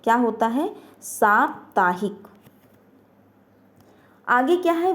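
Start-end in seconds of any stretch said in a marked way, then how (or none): 1.0-2.3 साप्ताहिक drawn out slowly, long आ in सा; slow model pronunciation. Shown as a demonstration. Teacher to students modelling the correct word, slow. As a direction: spell out साप्ताहिक slowly, saa-ptaa-hik. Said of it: "साप्ताहिक शुद्ध शब्द नहीं होता".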